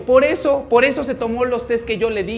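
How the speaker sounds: high, loud acted tirade; spiteful boasting. Paola taunts Samantha's parents with secrets, spiteful boasting.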